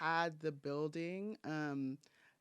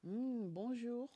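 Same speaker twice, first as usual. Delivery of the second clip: slow put-on French accent; 7 semitones higher, slower than usual. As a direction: greet in a mock French accent. Playful French greeting.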